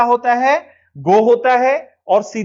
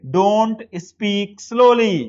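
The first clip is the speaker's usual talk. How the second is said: very slow, word by word model sentence; slow dictation; much slower than usual. Shown as a demonstration. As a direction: spell it out slowly for learners. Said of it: "मत शब्द के लिए क्या use करेंगे".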